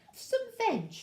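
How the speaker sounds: high hooted offer; high, hooty storybook dinosaur voice; hooting dinosaurs. A narrator's reading. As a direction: hoot it like a friendly duckbill. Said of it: "and they hooted".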